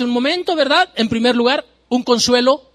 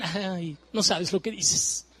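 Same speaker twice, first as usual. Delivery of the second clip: soft pitying lament; 9 dB quieter, slower than usual. Acted. Soft lament after loud part.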